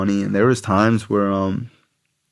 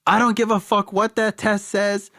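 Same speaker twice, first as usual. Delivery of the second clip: high, mock-shrill angry sister; sister's voice; 11 semitones higher than usual. Sister insisting to the tester that her brother is on drugs, high and mocking. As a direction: play the fed-up sister, pitched way up. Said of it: "my sister goes".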